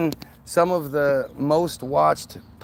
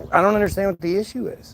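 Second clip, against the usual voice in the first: higher, quicker mock puzzlement; sarcastic; 5 semitones higher, faster than usual. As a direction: feign confusion you don't feel. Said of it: "I guess I'm being sarcastic when I say it".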